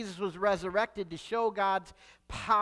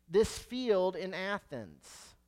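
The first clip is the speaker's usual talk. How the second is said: usual speaking voice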